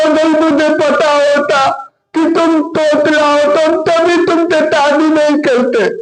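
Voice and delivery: high, tearful wailing complaint; crying bride. Acted. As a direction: sob through the words. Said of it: "रोने लगती है". (रोती हुई दुल्हन)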